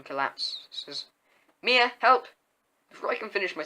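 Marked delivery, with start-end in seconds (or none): 1.6-2.3 high, loud cry for help; urgent call. A narrator's reading. Narrator voices urgent call for help.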